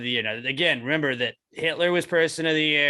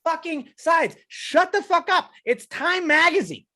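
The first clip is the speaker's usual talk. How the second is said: much higher and louder, furious ranting; heated rant; 8 semitones higher, 3 dB louder than usual. Real, not acted. Ranting at Elizabeth Warren's hypocrisy, real high loud fury. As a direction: let the anger about crooked politicians boil over.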